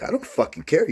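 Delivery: high, sharp retold snap; angry retort. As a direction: cut her pleading off dead. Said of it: "I was like".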